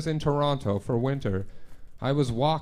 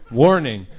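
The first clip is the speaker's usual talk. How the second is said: loud ominous warning; 10 dB louder than usual. Retold or imitated. Reread the warning, louder.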